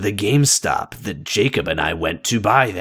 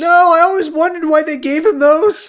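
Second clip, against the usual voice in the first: very high loud squeak; squeaky voice; 7 dB louder than usual. Pretend. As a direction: squeal it in mock outrage. High squeaky mock outrage.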